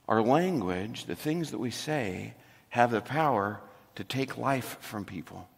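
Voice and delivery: hushed and sober. Real, not acted. Pastor confides to the congregation how words wound, softly. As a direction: let it land gently and heavy.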